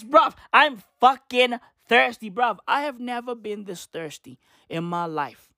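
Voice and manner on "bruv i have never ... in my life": usual speaking voice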